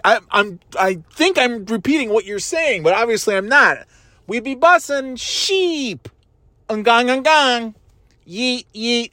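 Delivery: dad clowning in a high voice; hammed-up teen slang. Dad clowning for his kids in a high mock voice. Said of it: "I'm like".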